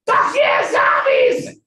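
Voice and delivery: loud, high party yell; shouted cheer. Retold or imitated. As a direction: holler it like a headbanger. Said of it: "They're like".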